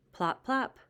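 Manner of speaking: usual speaking voice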